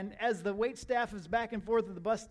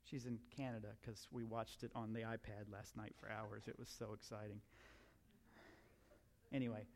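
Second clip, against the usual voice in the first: ordinary talk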